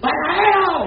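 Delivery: high, drawn-out street call; grito de pregón imitado. Retold or imitated. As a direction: call out across the village, stretching the word. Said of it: "Y entonces gritaban". (grito cantado y alargado)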